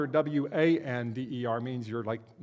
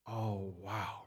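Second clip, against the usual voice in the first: low, hushed amazement; soft, drawn-out wonder; quietly stunned by the view; awestruck; 7 semitones lower, 9 dB quieter than usual. Acted. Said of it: "you have this moment, and you're like".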